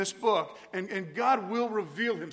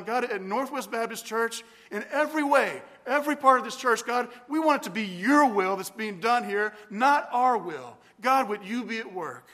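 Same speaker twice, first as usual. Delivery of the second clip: louder, pleading prayer for the church; praying for his church with rising intensity; fervent prayer; 5 dB louder than usual. Shown as a demonstration. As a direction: let the prayer swell with passion. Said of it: "but something like this".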